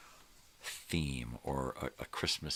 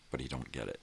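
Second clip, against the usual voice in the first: usual speaking voice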